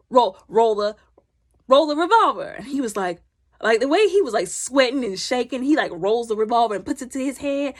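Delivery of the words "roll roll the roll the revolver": loud, halting demand; acted command. Questioner playing Russian roulette, acted command. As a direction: act out an insistent order, punching it louder.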